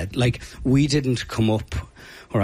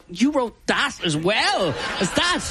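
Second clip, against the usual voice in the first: high, drawn-out mock astonishment; much higher incredulous exclamation, stretched out; amazed disbelief; 12 semitones higher than usual. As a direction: imitate a crowd in happy disbelief. Audience amazed at yet another hit.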